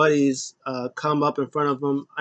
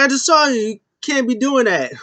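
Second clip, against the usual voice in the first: higher, louder mock referee telling him off; scolding ref; 8 semitones higher, 4 dB louder than usual. Referee scolding McAfee for pulling hair, high and loud. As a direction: play the ref catching him red-handed. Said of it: "He was like".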